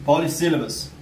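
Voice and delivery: ordinary talk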